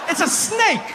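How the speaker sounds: squealed, incredulous protest; high-pitched exasperation. Acted. Exasperated punchline about fearing snakes.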